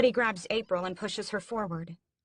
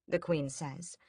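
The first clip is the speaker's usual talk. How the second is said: plain reading voice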